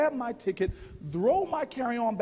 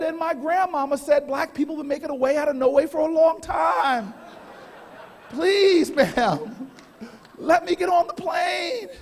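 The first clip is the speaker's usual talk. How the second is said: pleading shout; 5 semitones higher, 6 dB louder than usual. Retold or imitated. Traveler begging gate agent, loud pleading shout. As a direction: beg her at full voice. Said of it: "get a little ethnic on people".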